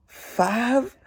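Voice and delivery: high, stunned single-word question; shocked. Acted. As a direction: gasp out the number in disbelief. Andrew's shocked reply in book.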